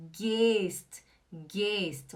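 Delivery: GEHST stretched as "gaaayst"; drawn-out model "gehst"; long E. Shown as a demonstration. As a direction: hold the vowel of gehst. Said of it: "O G E H é longo".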